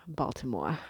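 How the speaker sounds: Baltimore accent, BALTIMORE with the final r dropped. Shown as a demonstration.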